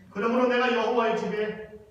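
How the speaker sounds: slow, swelling preacher's reading, ending loud and high; solemn scripture reading. Acted. Pastor lifts the psalm's last line to the congregation, slow and swelling. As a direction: read the verse with rising solemn force.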